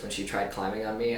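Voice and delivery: ordinary talk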